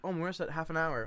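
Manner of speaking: usual speaking voice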